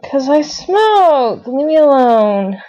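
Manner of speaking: irritated snap. Retold or imitated. Retold sulky shout at Natalie.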